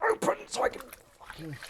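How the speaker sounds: high strangled strain; straining effort. Real, not acted. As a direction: force words out while wrenching something open. Struggling to open something.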